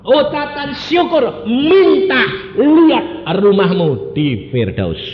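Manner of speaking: high, loud fervent preaching; full-throated sermon delivery; preaching at full force. Acted. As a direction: proclaim it to the whole church. Preacher urging mother to pray.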